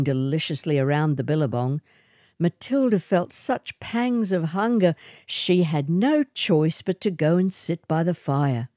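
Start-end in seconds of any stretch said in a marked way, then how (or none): none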